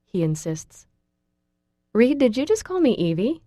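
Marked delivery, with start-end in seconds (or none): 1.9-3.4 high, startled question; a touch of shock. A narrator's reading. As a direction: caught off guard, voice jumps up. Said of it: "I ask in shock".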